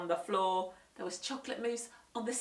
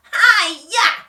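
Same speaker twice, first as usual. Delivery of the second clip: higher, much louder battle cry; karate shriek; 11 semitones higher, 20 dB louder than usual. Acted. Henry doing karate, loud shrieked hi-ya. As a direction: shriek the kick. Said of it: "shrieked Henry".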